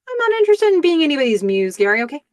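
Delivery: high, fast acted retort; confrontational. Woman turning back on her date in the street, acted confrontation. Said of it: "She is ready to be confrontational".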